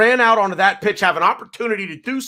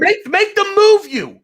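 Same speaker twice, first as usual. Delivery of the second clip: higher, louder and slow, driving the point; heated rant; 11 semitones higher, 5 dB louder, slower than usual. Real, not acted. Host venting at protesters, raised and slow. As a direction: hammer the demand home. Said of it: "I get a little angry".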